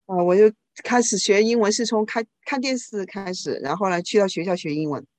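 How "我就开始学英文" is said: ordinary delivery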